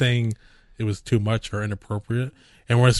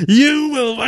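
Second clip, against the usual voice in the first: high, loud mock villain; a hammed-up shouting general; villain ranting; 7 dB louder than usual. Retelling Hux's speech to a friend, high loud mock rant. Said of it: "and he goes".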